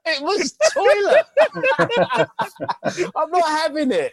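high, slow laughing dismissal; laughing scorn. Real, not acted. Laughing at the hosts over a crew he rates shit, high laughing scorn. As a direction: laugh it out in disbelief.

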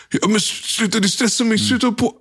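stressed pleading. Retold or imitated. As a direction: squeak it out like you're cornered and begging.

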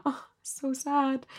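high, slow, put-on sad whine; mock sad whine. To mum about ringing the nursery back, a high slow mock wail. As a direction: stretch it out like a wounded puppy. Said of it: "I'm like".

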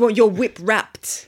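mock London slang. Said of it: "that accent".

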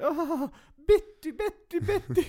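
high-pitched imitation of a screaming lady; mock scream. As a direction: shriek it like a distraught dog owner. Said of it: "en dam som lägger sig ner och skriker". (härmat gällt damskrik)

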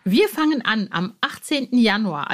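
normal talking voice

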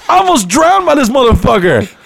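high, strained indignant outburst; pissed rant. Retold or imitated. Comedian venting at Henry after the pool, high indignant rant. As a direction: spit it out, furious and shaken.

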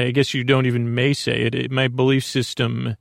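ordinary delivery

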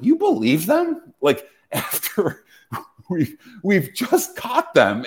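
high, drawn-out disbelieving question; incredulous. Retold or imitated. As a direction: retell it as a stretched, high-pitched "are you serious". Retelling own disbelief at others.

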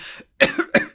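sharp cough from swallowing wrong; choking cough. Real, not acted. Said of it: "ich hab mich verschluckt".

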